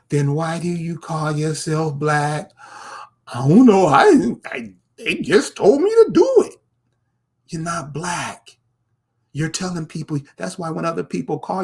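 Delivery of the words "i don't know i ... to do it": high, loud mock helpless reply; mock clueless answer. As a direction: squeal it, imitating someone who has no idea.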